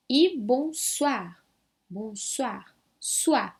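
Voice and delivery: "bonsoir" with oi as wa, bon-swar; French bonsoir. Shown as a demonstration. Teacher models "bonsoir" with wa sound. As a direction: say "soir" as swar. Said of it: "eu não vejo nenhum A".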